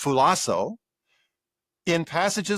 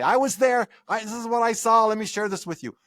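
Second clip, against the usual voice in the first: much higher, urgent firsthand telling; eager eyewitness; 8 semitones higher than usual. Retold or imitated. Eyewitness sharing with a listener, eager and higher-pitched. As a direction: play a witness keen to share what was seen.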